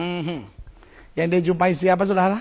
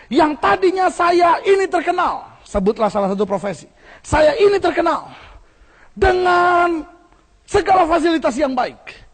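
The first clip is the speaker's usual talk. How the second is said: imitating the witness, higher and louder; quoted testimony; 7 semitones higher, 3 dB louder than usual. Witness telling the church of lost fame, voiced higher. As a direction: play the proud former celebrity, lightly. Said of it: "tapi dia bilang".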